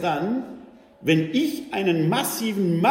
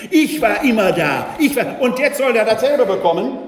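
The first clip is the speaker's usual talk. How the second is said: resentful sibling; 3 semitones higher, 8 dB louder than usual. Retold or imitated.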